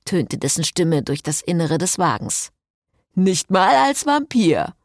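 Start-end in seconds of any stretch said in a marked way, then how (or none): 3.1-4.7 higher, drawn-out grumble; complaining Jason. A narrator's reading. Vampire Jason protests about his eardrums, complaining. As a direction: grumble it slowly in a put-on voice.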